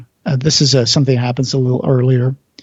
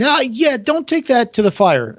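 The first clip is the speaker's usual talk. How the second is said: Goya's protest; 9 semitones higher than usual. Retold or imitated. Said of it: "He says".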